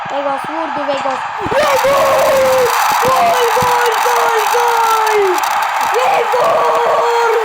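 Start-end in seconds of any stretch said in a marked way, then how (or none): 1.5-7.5 roaring goal call, high and loud; screaming football commentator. Real, not acted.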